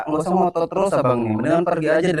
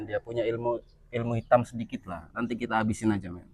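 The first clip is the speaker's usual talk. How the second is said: much softer, low-key aside; confiding aside; 3 semitones lower, 10 dB quieter than usual. Real, not acted. Man sizing up the preman to his companion, hushed aside.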